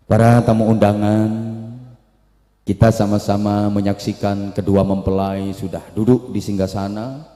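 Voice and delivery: low, loud ceremonial MC voice; wedding MC. Acted. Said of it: "kemudian M C-nya ngomong".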